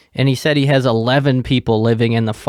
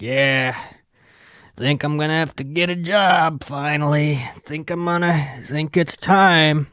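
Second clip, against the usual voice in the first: imitated drunk neighbour; 3 semitones higher than usual.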